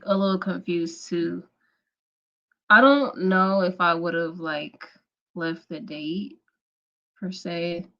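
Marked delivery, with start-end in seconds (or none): none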